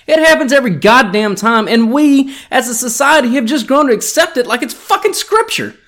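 ranting sponsor read, building loud. Acted. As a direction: hype up the fake ad into a rant. Parody ad read, building to a rant.

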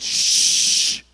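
long hissed shush. Acted. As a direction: shush someone into silence. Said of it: "They went over and said".

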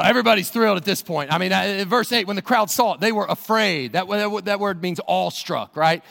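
ordinary talk

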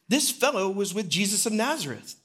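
high, insistent pointing-out; a second servant girl naming Peter to the crowd; accusing servant girl. Retold or imitated. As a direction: expose him to the crowd.

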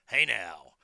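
Hey now in a low, loud big guy voice. Shown as a demonstration. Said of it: "That's a big guy voice there".

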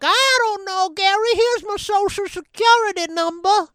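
a frail granny giving it all away; poor old lady. Retold or imitated. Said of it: "some poor old lady who's like".

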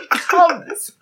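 usual speaking voice

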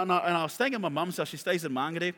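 usual speaking voice

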